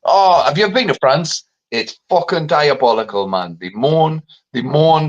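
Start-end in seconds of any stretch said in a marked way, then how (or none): none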